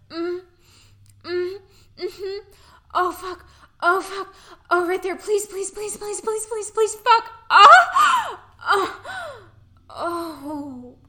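rising to loud, high, breathless cries; climax moans. Acted. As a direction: build from moans to a full scream. Building to climax, moaning.

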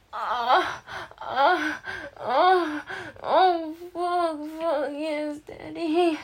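loud, high moaning outburst; ecstatic cries. Acted. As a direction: cry out at the peak. Performed climax.

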